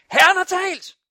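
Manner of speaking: high, loud declaration of faith; full-force preaching shout; shouted proclamation. Acted. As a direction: shout it into the mirror with total conviction. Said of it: "Råb ind i spejlet".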